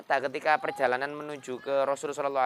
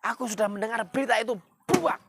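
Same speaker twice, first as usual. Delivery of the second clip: high, loud and fast furious yell; angry shout; 12 semitones higher, 4 dB louder than usual. Acted. Umar bursts in on his sister, shouting angrily. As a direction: bark it in rage. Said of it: "sambil marah gitu".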